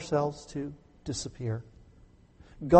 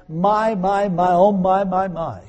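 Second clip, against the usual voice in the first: mock tutting; 4 semitones higher, 4 dB louder, slower than usual.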